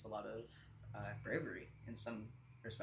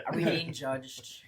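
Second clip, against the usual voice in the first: mock worried; 3 semitones higher, 10 dB louder, slower than usual. Real, not acted. Mock worry about ages.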